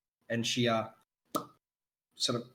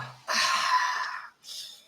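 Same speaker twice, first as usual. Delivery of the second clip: put-on a long contented drunk's sigh after a drink; satisfied sigh. Drunk man sighs happily after gulping the ale. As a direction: breathe out pleased after the swig. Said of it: "Why the fuck is he British now".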